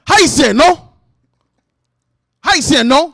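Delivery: loud, high mock interrogation; rude questioning. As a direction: imitate someone bluntly demanding an answer. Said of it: "Don't be a rude piece of shit".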